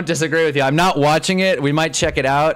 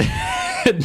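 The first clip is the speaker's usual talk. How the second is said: amused laugh. Real, not acted. Laugh at viewer's comment.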